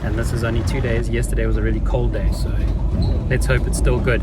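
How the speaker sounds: talking while bouncing in a truck; on a bumpy road. Real, not acted. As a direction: voice jolted by a rough track. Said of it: "Sorry about the bumpy road".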